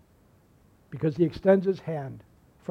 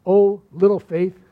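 "Oh, little faith" drawn out slowly and louder, like a pet name; fond nickname; 7 dB louder, slower than usual. Shown as a demonstration. Jesus gently teasing doubting Peter, slow and affectionate. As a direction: call him by an affectionate nickname. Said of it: "kinda used it like a nickname".

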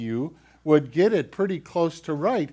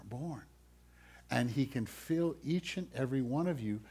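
ordinary talk

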